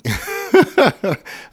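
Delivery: a sudden high laugh breaking out; laughing at his own example; real laugh. Real, not acted. Teacher laughs at a joke about himself, high burst.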